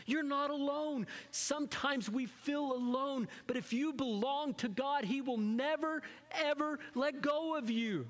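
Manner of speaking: fervent sermon reassurance; impassioned preaching. Acted. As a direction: build it up like a pulpit climax. Preacher rises to full force.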